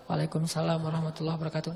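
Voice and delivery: reply under your breath so he can't hear; answered softly. Retold or imitated. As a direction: companion quietly answers the Prophet's salam. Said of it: "saya pelankan suara".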